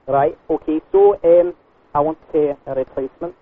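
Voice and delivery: put-on Scottish customer with a complaint; Scottish lilt drifting into Australian; Scottish accent. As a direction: do a muddled Scottish accent on a prank call. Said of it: "talking Australian with the Scottish".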